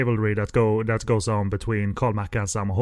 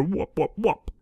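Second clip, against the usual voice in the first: fake foreign language; 6 semitones higher, 4 dB quieter, slower than usual. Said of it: "fake language as an interpreter".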